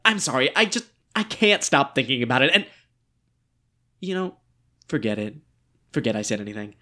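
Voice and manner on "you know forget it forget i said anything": much softer and lower, pulling back; withdrawn. Acted. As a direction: drop it, embarrassed. Backing off after oversharing.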